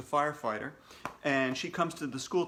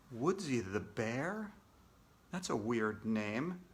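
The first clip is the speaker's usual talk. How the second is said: softer, lower, slow skeptical aside; doubtful aside; 5 semitones lower, 8 dB quieter, slower than usual. Acted. AJ doubting the bear's name, soft aside. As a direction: mutter it with disbelief.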